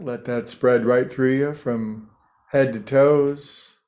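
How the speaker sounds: normal talking voice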